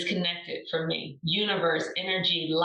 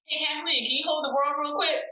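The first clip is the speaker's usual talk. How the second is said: higher and quick, playful ribbing; teasing mock request; 8 semitones higher, faster than usual. Cohost teasing Kathleen about carrying the world, high and quick. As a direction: toss it off as a cheeky joke.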